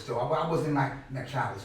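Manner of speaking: ordinary talk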